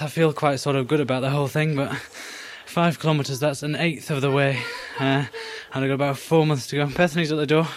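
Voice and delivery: normal talking voice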